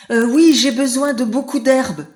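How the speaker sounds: cri aigu et fort; crie. A narrator's reading. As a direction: crier pour que le rat géant entende. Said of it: "crie Éléphanto". (Éléphanto crie fort)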